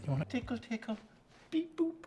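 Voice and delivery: high, soft sing-song "beep, boop"; playful baby talk. Acted. Playful baby-talk while applying blush.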